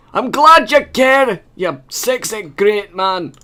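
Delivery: high, slow and hammy; hammed-up bodyguard voice. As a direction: flirt back in a high, drawn-out put-on voice. Bodyguard replies in voice.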